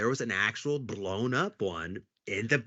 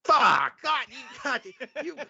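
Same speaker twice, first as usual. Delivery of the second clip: mock furious outburst; 12 semitones higher, slower than usual. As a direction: imitate the detective blowing up at the pranksters. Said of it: "You hear it".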